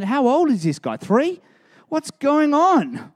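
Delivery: mock incredulous. Mocking Jonah as a toddler.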